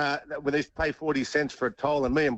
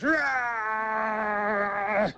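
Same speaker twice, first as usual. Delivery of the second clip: a sudden high growling roar to scare someone; mock roar; 7 semitones higher than usual. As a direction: bark a playful "Rah!" like a threatening mate. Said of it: "Blocker leans over and goes".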